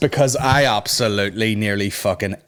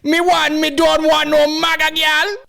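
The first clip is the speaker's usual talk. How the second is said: squeaky, high mock Jamaican accent; hammed-up Jamaican patois mimicry. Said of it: "Why is he talking like that?".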